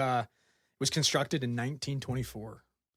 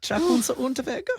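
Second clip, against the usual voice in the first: name said in a hammed-up German accent, higher and louder; best German accent; 12 semitones higher, 7 dB louder than usual. Shown as a demonstration. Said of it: "in my best accent".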